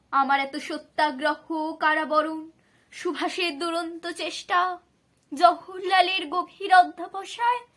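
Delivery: high, very loud, mounting lament; impassioned outcry. A narrator's reading. Bapuji counts what was given for the country, impassioned outcry.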